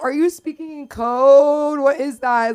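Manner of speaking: high, loud, drawn-out disbelief; baffled retort. Retold or imitated. Daughter questions mom's talk of a love bite, baffled. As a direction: throw mom's odd phrase back at her, incredulous.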